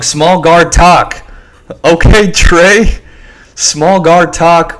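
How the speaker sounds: hyped reading. Real, not acted. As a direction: savour the tweet loudly. Reacting to tweet.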